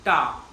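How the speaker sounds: "ta" with a flat tongue tip; syllable ta. Shown as a demonstration. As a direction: say "ta" crisply, tongue tip behind the teeth. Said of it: "you're pronouncing this as".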